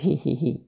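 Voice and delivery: sly little witch titter hi hi hi; a sneaky cackling giggle; witch's giggle. Acted. Heks bakt nieuwe pannenkoeken. (heks giechelt geniepig hi hi hi)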